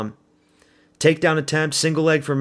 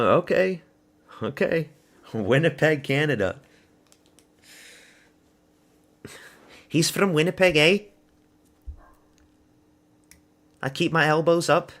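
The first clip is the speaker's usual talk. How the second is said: higher-pitched mock Canadian accent; imitation Canadian, pitched up; 5 semitones higher than usual. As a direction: put on a playful Canadian voice. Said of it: "how to do the Canadian accent".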